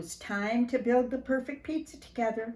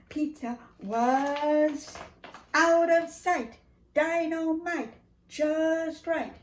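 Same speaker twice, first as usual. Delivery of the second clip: excited climax; 8 semitones higher, 4 dB louder, much slower than usual. Acted. Triumphant climax of story.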